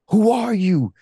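high, loud desperate cry re-enacted; screaming out. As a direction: call up to the unknown, pitched high and loud. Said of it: "I'm screaming out".

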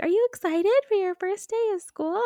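high, bright-eyed reporter question; chirpy newscaster. Retold or imitated. Mimicking a newscaster asking a kid, high and cheery. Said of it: "the newscaster is like".

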